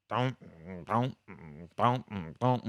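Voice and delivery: spaced-out thumping car noise; imitated car thump. Driver mimics the car's noise an hour into the trip, rhythmic thumps. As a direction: mimic a wheel thumping, beat by beat. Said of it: "What the fuck is this sound?".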